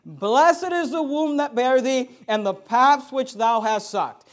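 slow, loud, declaimed Bible verse; scripture proclamation. Acted. Preacher reading the woman's words to Jesus, slow loud proclamation. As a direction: proclaim the verse slowly and strongly. Reads scripture aloud emphatically.

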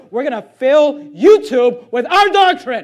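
slow, forceful pulpit declaration; rallying vow. Real, not acted. Preacher answering what the church will do, rallying vow. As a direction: proclaim it as a battle cry, word by word.

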